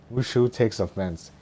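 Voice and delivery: ordinary delivery, nothing marked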